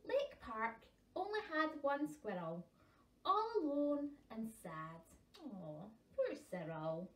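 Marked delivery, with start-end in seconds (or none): none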